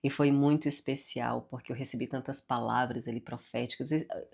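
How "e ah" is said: ordinary talk, nothing marked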